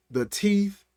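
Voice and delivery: usual speaking voice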